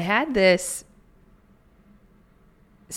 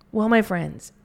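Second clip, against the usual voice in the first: ordinary talk, nothing marked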